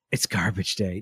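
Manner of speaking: put-on low, soft voice of a fixated kid; hushed child imitation. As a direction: imitate a small boy's quiet announcement. Voicing son's hushed insistence.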